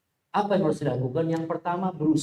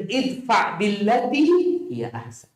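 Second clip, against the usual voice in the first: slow Quranic recitation; 7 semitones higher, slower than usual. Acted. Quoting Quran verse.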